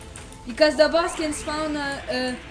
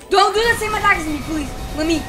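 fast pleading; 4 semitones higher, 5 dB louder, faster than usual. Real, not acted. Pleading again.

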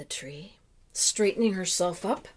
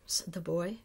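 ordinary delivery, nothing marked

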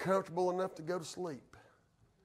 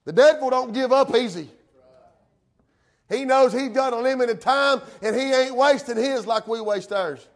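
raised pulpit warning turning to praise; fervent preaching; 7 semitones higher, 8 dB louder than usual. Real, not acted. Preacher warns of the devil's return, fervent preaching. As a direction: warn the church with rousing force.

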